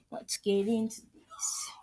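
slow whisper. Acted. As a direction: whisper it, creeping in. Secret sneaking.

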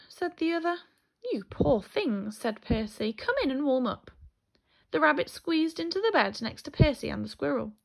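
lively picture-book storytelling; reading to children. A narrator's reading. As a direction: tell the story to small children, animated.

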